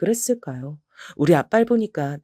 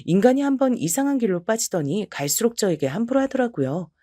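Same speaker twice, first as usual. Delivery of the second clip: ordinary delivery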